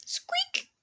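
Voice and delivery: very high, soft tiny squeak; little mouse squeak. Pretend. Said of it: "that was a hiccup".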